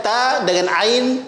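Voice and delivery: ordinary talk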